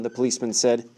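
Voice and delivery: ordinary delivery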